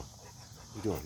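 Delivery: hushed and slow, cooing at the dog; soft slow dog talk. Real, not acted. Man softly coos to his dog, slow and hushed. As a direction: ease off and coo it gently.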